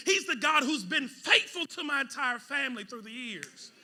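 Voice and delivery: loud, high preaching; shouting sermon; full-force preaching. Acted. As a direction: lift the room to a peak.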